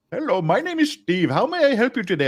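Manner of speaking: put-on lilting Indian-accented phone greeting; Indian call-center accent. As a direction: imitate an Indian support agent's sing-song greeting. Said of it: "I got the international part".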